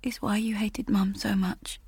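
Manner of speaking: soft whisper lying in bed. Acted. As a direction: breathe it out in the dark. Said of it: "she whispered".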